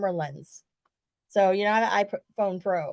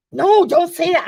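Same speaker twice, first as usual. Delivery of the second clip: mock protest; 10 semitones higher, 9 dB louder than usual. [name] protesting his nickname.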